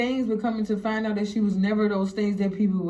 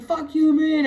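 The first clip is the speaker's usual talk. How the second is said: louder, higher-pitched angry retort; retold argument, voice raised; 7 semitones higher, 5 dB louder than usual. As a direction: fire back in a heated argument. Acting out the start of an argument.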